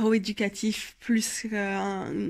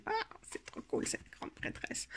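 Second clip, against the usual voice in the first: whispered delight; 13 semitones higher, 13 dB quieter than usual. Real, not acted. Admires a card aside.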